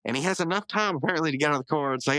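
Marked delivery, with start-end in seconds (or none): none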